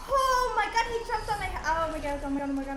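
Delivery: panicked, gasping oh my god; scared horror game streamer. Real, not acted. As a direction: babble in real fright, calming down. Monster jumped on him.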